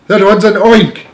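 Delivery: loud, high alarmed yell; panicked scream. A narrator's reading. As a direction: shout in fright at the sight. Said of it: "screamed the head of the fire department".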